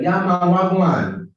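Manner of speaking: loud, high Jamaican patois greeting; big Jamaican patois demo. Shown as a demonstration. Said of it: "Patois is like when you hear the Jamaican accent".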